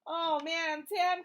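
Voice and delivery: ordinary delivery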